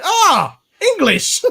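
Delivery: high, bright cheerful exclamation; mock cheerful shopkeeper. Subway clerk greeting a tourist, high and cheerful. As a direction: chirp it like a delighted shop clerk. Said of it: "And she went".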